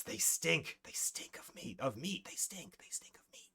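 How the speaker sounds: unvoiced, hissing whisper-shout from hiding; hissed shout. Hidden forest creature hissing at the party, harsh whispered yell. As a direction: spit the words out in a rasping hiss. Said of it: "One shouts".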